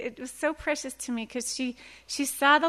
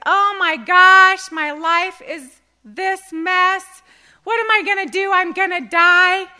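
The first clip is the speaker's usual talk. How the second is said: loud, slow, higher hammed-up panic; mock panic; 6 semitones higher, 11 dB louder than usual. Believer panicking on the ragged edge, mock wail. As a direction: wail in mock despair at the mess. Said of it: "it's like".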